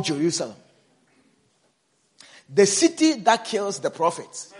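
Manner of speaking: loud, emphatic preaching; full-force preaching. Acted. Preacher pressing the congregation about killing prophets, loud. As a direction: drive the point home at full volume.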